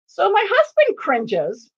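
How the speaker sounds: ordinary talk, nothing marked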